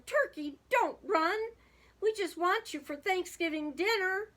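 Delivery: high, pleading holler; urgent calling after. A narrator's reading. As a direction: call after someone running away. Moose chasing fleeing turkey.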